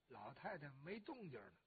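usual speaking voice

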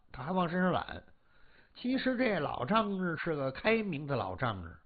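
usual speaking voice

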